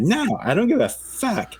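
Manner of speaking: sudden high-pitched mock defiance; high, hammed-up imitation of the wife brushing them off; mock defiant wife. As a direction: toss it off way up high, daring them. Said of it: "All mad".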